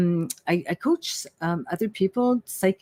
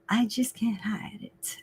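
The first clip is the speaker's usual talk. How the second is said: breathy whispered aside; excited whisper; 3 semitones higher, 9 dB quieter than usual. Real, not acted. Psychic sharing her office news, whispered aside. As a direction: whisper it like a secret.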